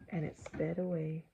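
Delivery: ordinary delivery, nothing marked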